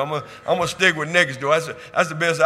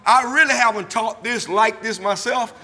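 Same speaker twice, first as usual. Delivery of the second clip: louder, higher preaching that swells into shouts; full-force preaching; 5 semitones higher, 9 dB louder than usual. Acted. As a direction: preach it out to the congregation, building hard. Preaching resumes after glasses remark.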